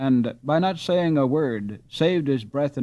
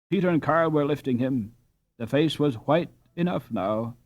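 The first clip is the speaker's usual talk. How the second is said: usual speaking voice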